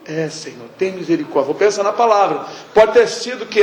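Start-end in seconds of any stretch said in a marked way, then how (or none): 0.1-1.5 much softer, low plea for mercy; hushed plea to the Lord; whispery prayer. Shown as a demonstration. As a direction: barely voice it, begging mercy. Shows a murmured prayer.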